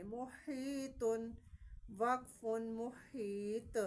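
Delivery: محیط with the final ط bounced out; slow محیطن, then stop with an echoing ط; qalqala on the stop. Shown as a demonstration. Teacher repeating the qalqala stop for pupils. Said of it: "اس لیے اس پہ قلقلہ کریں گے".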